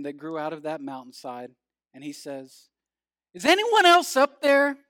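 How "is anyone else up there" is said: high, loud call up the cliff. Acted. Hanging man seeking a second opinion, calling out. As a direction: holler up for some other helper.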